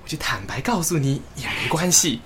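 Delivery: sheepish confiding. Acted. Sheepishly admits a secret to angel.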